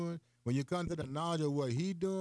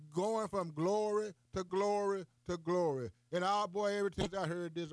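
higher preaching, slowing on glory; full-force preaching; 7 semitones higher than usual. Real, not acted. Preacher about the main event, full-force preaching. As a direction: lift it and preach it out.

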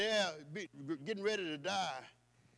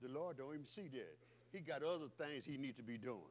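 much softer, lower, sly; voicing the scheming wicked; 5 semitones lower, 12 dB quieter than usual. Preacher voicing the wicked plotter, soft and sly. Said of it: "Listen good".

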